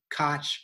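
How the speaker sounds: Koch ending in an English "tch"; Koch as "cotch". Shown as a demonstration. Author trying a last version of the name, Koch as "cotch". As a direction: say Koch to rhyme with "watch". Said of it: "sometimes I pronounce it".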